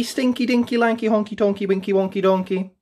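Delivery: ordinary delivery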